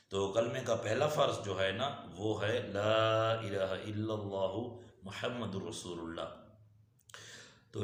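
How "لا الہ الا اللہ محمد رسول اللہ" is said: slow, drawn-out Arabic recitation; kalima recitation. Real, not acted. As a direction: hold the long vowels, measured and even. Recites the kalima as first duty.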